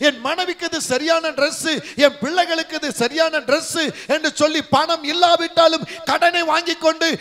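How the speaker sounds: high, driving sermon delivery; full-force preaching. Real, not acted. Preacher reproaching the congregation for borrowing to shop, full-force preaching.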